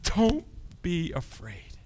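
tender reassurance; gentle reassurance. Acted. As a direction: soothe the fallen man. Jesus comforting John.

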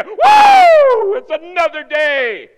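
high, shouted morning cheer; whoop, then proclaim it loudly; jubilant whoop. Acted. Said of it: "you should go".

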